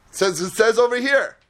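usual speaking voice

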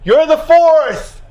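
high, loud, very slow shouted reply; an operator shouting up to someone stuck on the zip line; yelled back from afar. Retold or imitated. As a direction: call it out across a long gap. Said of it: "The guy screams back".

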